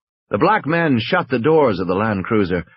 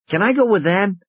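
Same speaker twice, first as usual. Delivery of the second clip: put-on child's voice; 9 semitones higher than usual. Child Tim asking.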